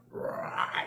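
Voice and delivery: imitated demonic growl. Showing how his possessed date sounded, demonic growl. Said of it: "in this demonic voice".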